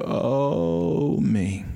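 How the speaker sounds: long drawn-out moan, high then sinking; drawn-out, falling groan of a sick man; imitated moan. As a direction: imitate an aged man moaning in pain. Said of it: "the way he would moan characteristically".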